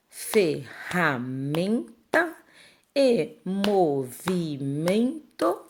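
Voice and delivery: "ferramenta" and "movimento" said very slowly, syllables apart; split into syllables. Shown as a demonstration. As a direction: stretch each syllable for the class. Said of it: "é só separar". (sílabas bem separadas e lentas)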